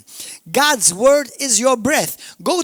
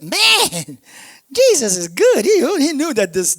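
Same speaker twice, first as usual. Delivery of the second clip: high, excited outburst at a discovery; amazed exclamation; 5 semitones higher, 4 dB louder than usual. Retold or imitated. Preacher retelling his reaction to the scripture, amazed. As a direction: exclaim it in wonder, louder on the first words.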